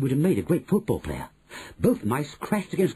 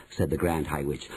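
usual speaking voice

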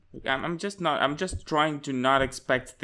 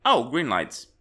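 ordinary delivery

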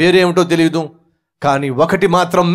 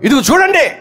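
angry shout; 8 semitones higher, 3 dB louder than usual. Acted. Wife protesting to husband.

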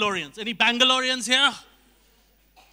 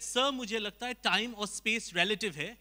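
fast, soft, meek Hindi explanation; Einstein as Hindi speaker; 2 semitones lower, 7 dB quieter, faster than usual. Acted. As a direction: rattle off a humble theory quietly. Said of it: "Albert Einstein could have said".

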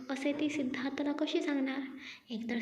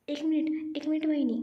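higher, hurried stop; quick; 4 semitones higher, 3 dB louder than usual. A narrator's reading. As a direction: quickly call her back. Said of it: "निशा पटकन बोलते".

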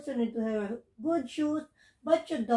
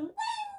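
squeaky sparkle ding; high magic ding; 7 dB quieter than usual. Pretend. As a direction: chime it like a wand. Magic wand spell.

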